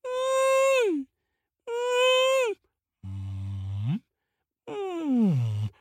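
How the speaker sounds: long moans, mostly high; wordless wail on the wind; mysterious wailing sound. A narrator's reading. Eagle listens to the valley sound, eerie wail. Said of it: "the eagle listens and he hears".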